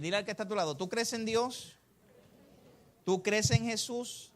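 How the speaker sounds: usual speaking voice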